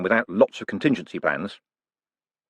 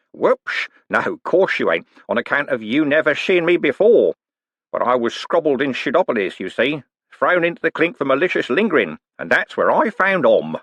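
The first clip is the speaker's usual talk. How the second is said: higher, wheedling rogue's voice with a knowing lilt; sly old conman; 6 semitones higher, 3 dB louder than usual. Acted. Old con man teasing Moist about his past, sly and wheedling. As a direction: needle him like a grinning old crook. Cribbins voice again.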